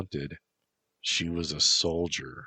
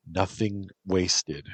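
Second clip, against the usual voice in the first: plain reading voice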